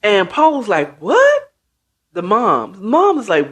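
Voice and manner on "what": high-pitched, sharply rising mock shock; shocked disbelief. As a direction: squeak it out as a stunned mom. Acted mother reacting to shocking news.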